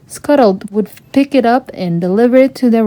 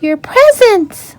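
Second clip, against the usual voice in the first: much higher, slow astonished gasp; surprised; 12 semitones higher, 3 dB louder, slower than usual. A narrator's reading. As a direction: realise it suddenly. Ariel finds missing gifts.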